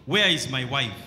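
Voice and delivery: ordinary talk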